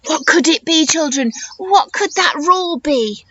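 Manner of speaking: plain reading voice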